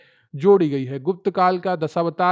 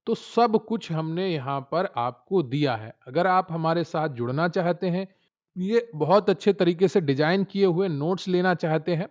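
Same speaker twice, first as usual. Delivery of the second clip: usual speaking voice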